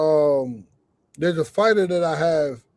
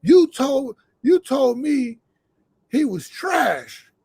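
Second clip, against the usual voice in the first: indignant protest; 6 semitones higher, much slower than usual. Retold or imitated. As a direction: hit each word, insisting he said it. Retold protest to coach.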